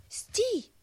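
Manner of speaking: very high, loud halt call; shouted command. A narrator's reading. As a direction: hedgehog crying out to stop. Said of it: "крикнув їжачок".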